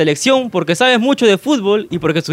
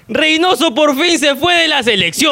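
slow, higher announcer-style read of the headline; slow, raised hypothetical-question read; dramatic reading; 6 semitones higher, slower than usual. Acted. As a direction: read it out like breaking news, drawn out. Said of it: "A ver, dice".

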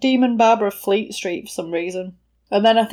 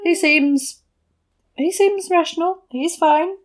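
high, drawn-out, hedging yeah; pitched-up, hesitant assurance; unconvincing reassurance; 7 semitones higher than usual. Acted. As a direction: vouch for him without quite being sure. Acted hesitant reassurance to the doctor.

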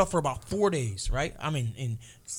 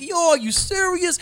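disbelief; 11 semitones higher, 6 dB louder than usual. Retold or imitated. Retold disbelief at arrest.

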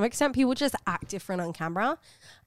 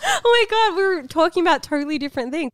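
high, laughing disbelief; 9 semitones higher, 5 dB louder than usual. Real, not acted. Podcast host amused at the mix-up, real laughing exclamation. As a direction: crack up while getting the words out. Cracking up at the misunderstanding.

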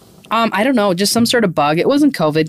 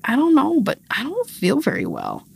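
put-on child voice starting high, falling away softer; imitating sick toddler; 6 semitones higher, 4 dB quieter than usual. Mother quoting her toddler's answer to what hurts, child voice. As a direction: play a poorly little kid, unsure what hurts. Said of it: "And she's like".

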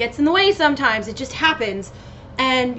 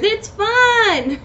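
excited; 9 semitones higher than usual. Real, not acted. Cheerful exclamation after shrugging off.

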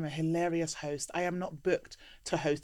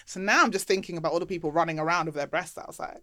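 usual speaking voice

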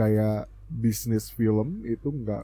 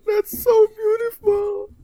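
much higher, slow swooning gush; 4 dB louder, slower than usual. Real, not acted. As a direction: gush like a fan. Gushing over time travel scene. (terpukau, suara tinggi)

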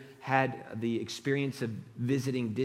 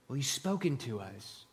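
normal talking voice

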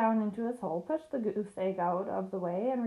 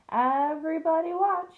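loud, high call for attention; proud show-off shout, pitched up; excited cry; 6 semitones higher, 6 dB louder than usual. A narrator's reading. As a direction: cry out to gather an audience. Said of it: "he cried".

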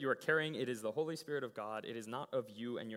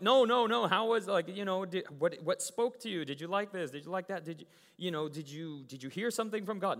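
louder, higher, insistent re-enacted questions; urgent questioning; 4 semitones higher, 6 dB louder than usual. Leader quizzing the kids about the message, urgent questioning. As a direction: press them eagerly for an answer.

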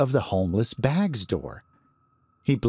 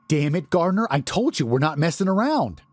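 higher-pitched, worked-up objection; exasperated protest; 8 semitones higher than usual. A narrator's reading. Larry protests to the guard at the door, exasperated and high. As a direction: plead urgently, voice lifted.

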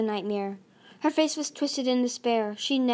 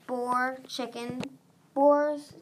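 ordinary delivery, nothing marked

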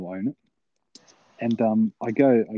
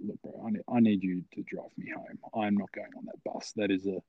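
quiet plea; 8 dB quieter than usual. Retold or imitated. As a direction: beg a friend softly, as retold. Retelling his plea for a lift.

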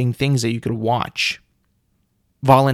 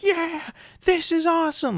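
mocking dim fan. Mocking hot dog contest fans who cheer, high dopey voice. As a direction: parody a brainless fan cheering. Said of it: "and you're like".

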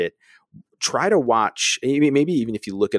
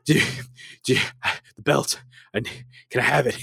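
mock out of breath; 6 semitones higher, faster than usual. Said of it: "I'm, like, out of breath at this point".

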